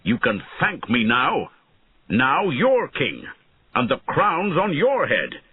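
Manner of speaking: defiant shout. A narrator's reading. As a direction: raise the voice to taunt the young king. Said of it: "he shouted at Einan".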